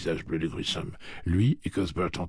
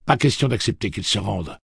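firm defiance; 5 semitones higher, 6 dB louder than usual. Acted. Roland's grim battle vow.

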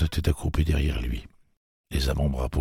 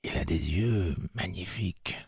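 put-on soft, much quieter boy's voice; hushed delight; 4 semitones higher, 7 dB quieter than usual. A narrator's reading. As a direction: breathe it in awe. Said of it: "il ne voulait pas effaroucher la créature".

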